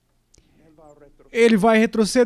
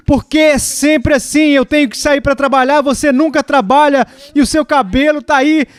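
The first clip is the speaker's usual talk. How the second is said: raised-pitch acted exasperation at his wife; angry husband; 5 semitones higher than usual. Husband losing patience with his wife before work, mock-angry. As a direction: play the fed-up husband, pitched up but not shouting. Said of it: "ele fica com raiva assim".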